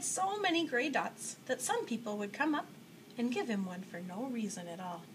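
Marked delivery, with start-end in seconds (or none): none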